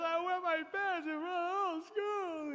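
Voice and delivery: put-on high-pitched wailing child cry; mock bawling. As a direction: sob it out to mom, hammed-up. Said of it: "I was bawling".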